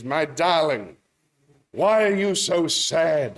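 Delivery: drawn-out, over-sympathetic Englishman's question; old Englishman. Acted. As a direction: play a theatrical old Englishman. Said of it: "An old Englishman's walking down the beach".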